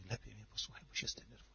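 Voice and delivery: weak whisper. Retold or imitated. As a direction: rush it out in a faint whisper. Daughter whispering weakly.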